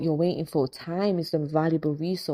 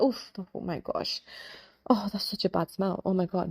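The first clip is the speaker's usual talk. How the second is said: disgusted; 4 dB quieter than usual. Pretend. Gagging at the bad smell, mock disgust. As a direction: recoil from the stink, overplayed.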